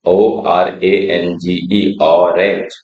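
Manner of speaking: put-on slow, careful spelling for children. Shown as a demonstration. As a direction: spell it out slowly, letter by letter. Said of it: "orange देखिए". (धीरे-धीरे spelling)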